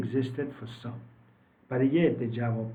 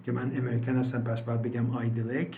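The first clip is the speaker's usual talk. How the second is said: ordinary delivery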